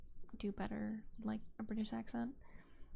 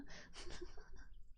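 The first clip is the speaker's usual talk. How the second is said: quiet airy laugh under the breath; soft amused laugh; soft breathy laugh; 10 dB quieter than usual. Real, not acted. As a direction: let a soft laugh slip out. Laughs at own comeback.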